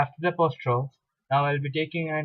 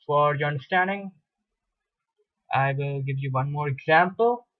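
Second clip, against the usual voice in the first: usual speaking voice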